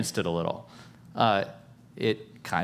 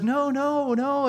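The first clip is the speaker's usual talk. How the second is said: much higher, insistent protest; insistent servant girl; slower than usual. Retold or imitated. Servant girl insisting to the disciples, high protest. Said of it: "she's like".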